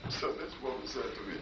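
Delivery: ordinary talk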